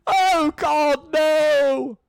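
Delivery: hammed-up despairing shout; anguished scream. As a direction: howl it from outside. Edmund screams offstage.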